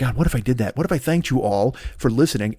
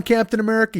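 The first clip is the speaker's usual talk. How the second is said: ordinary talk, nothing marked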